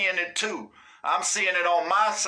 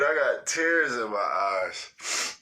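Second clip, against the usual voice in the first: laughing to tears; 6 semitones lower, slower than usual. Real, not acted. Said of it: "I'm up here crying".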